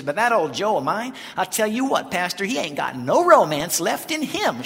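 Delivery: loud, high, feisty Southern granny; imitated complaining Grandma. Grandma to pastor about her husband, imitated feisty complaint. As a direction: complain about him right in front of him. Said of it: "she pointed to her husband".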